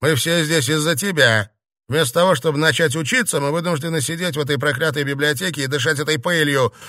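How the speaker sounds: heated rant at companions; angry blame. A narrator's reading. Emil blames the others angrily.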